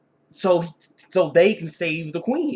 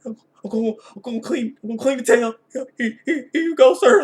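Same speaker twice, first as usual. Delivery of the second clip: higher, rushed, nervous voice rising to a loud squeak; scared servant; 7 semitones higher, 6 dB louder than usual. Retold or imitated. Colt trembling before the king, high panicky stammer. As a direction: stammer like you're terrified of the king. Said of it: "like, shaking".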